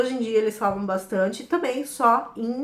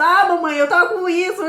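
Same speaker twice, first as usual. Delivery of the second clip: put-on higher, louder little boy excuse; child's voice; 7 semitones higher, 7 dB louder than usual. Son answering mother at the fridge, higher child voice. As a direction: squeak it out like a small boy.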